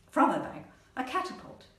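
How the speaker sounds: usual speaking voice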